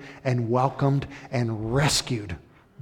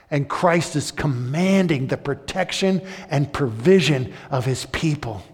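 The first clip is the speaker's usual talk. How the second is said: loud, high, ringing sermon delivery; full-force preaching; 5 semitones higher, 7 dB louder than usual. Acted. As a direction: proclaim it to the whole hall.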